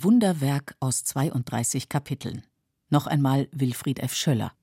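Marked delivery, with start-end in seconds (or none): none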